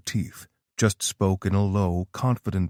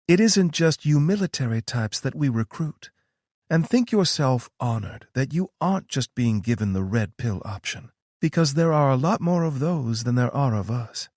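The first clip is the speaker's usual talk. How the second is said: higher, knowing female voice; a knowing, higher-pitched Tara; Tara's voice; 6 semitones higher than usual. A narrator's reading. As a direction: play Tara, calm and sure of herself.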